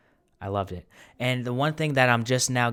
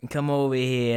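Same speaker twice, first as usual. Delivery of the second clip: hammed-up Italian-American mobster beckoning; Paulie Walnuts; 3 semitones higher, 3 dB louder than usual. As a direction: summon them like an Italian-American wise guy. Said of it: "It's like Sopranos, Paulie Walnuts".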